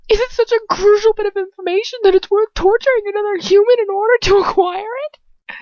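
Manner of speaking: high, loud, cracking voice; loud, high, breaking plea. Acted. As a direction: let your voice crack with fear. She furiously challenges him.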